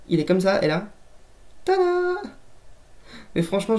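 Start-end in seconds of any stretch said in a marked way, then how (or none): 1.6-2.2 high, bright tada flourish; spring the reveal on them. Acted. Showing viewers the toy's moving eyes, high tada.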